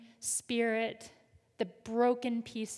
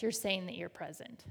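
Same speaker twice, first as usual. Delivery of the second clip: normal talking voice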